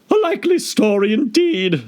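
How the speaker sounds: very high sneering bird; contemptuous pigeon. Acted. Pigeon scorning Alice. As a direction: scoff at her. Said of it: "in a tone of the deepest contempt".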